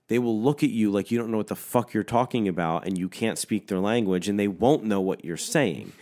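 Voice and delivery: ordinary talk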